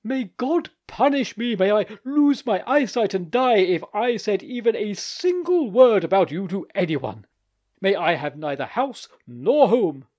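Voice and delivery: high, loud desperate swearing; exclaimed oath. Acted. Vankin swears to God before the icon, loud exclamation.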